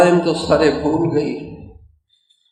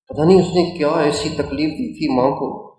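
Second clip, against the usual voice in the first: ordinary talk, nothing marked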